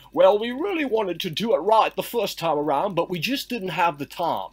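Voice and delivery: put-on a lightly done, vague British accent; odd put-on British accent. Co-host mocks his fake British accent. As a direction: put on a shaky attempt at British. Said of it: "It's a new accent".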